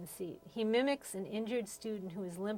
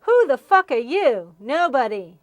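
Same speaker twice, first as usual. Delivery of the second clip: louder, higher scornful yell; 3 semitones higher, 14 dB louder than usual. Retold or imitated. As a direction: shout it down at the teacher. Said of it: "she says".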